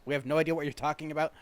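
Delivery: normal talking voice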